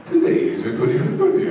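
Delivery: very high, fast fawning servant line; high servant greeting. Retold or imitated. Servant announcing the prince's birthday, very high and quick.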